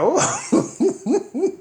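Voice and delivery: high surprised laugh; mock amazement at the fight; high whoa. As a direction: squeal it in amazement. Reacting to the fight.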